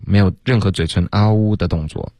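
ordinary delivery

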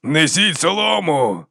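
roared order. A narrator's reading. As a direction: bellow a command to the captain. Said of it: "заревів Яков капітану".

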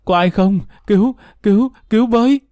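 high-pitched cry for help, rising; frantic boy, not full volume; acted wailing shout. A narrator's reading. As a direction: plead for help in mounting desperation. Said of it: "Nó tiếp tục gào".

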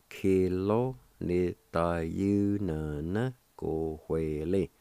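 Kilo ni ta yu na na ko hue li, each syllable said apart and slowly; slow syllable by syllable teaching demo; broken down slowly. Shown as a demonstration. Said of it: "Kind of break 'em down, say 'em slowly".

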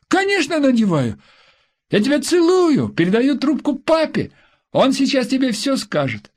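put-on high, quick little boy's voice; eager child. A narrator's reading. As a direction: chatter like an eager kid. Child answering, passing phone. (торопливый детский голос)

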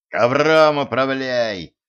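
slow, drawn-out order; commanding. A narrator's reading. Koschei leaves Zhenka to steer the carpet, commanding. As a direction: order it firmly, stretching the words. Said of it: "велел Кощей".

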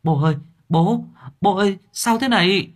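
panicked son. Acted. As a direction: shake him awake in panic. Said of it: "nó cuống cuồng chạy tới lay".

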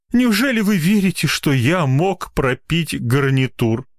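high-pitched, pity-seeking plea; tragic. A narrator's reading. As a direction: lament theatrically to win the doctor's pity. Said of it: "продолжал он трагическим голосом".

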